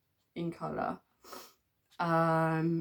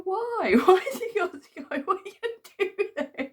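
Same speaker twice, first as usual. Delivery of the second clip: high, loud pleading through laughter; mock crying plea; 10 dB louder than usual. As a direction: beg dad not to take the dog, half laughing. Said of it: "So I started crying".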